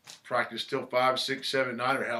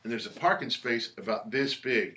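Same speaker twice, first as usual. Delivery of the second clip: ordinary delivery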